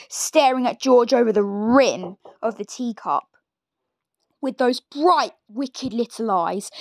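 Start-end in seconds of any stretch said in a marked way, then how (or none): none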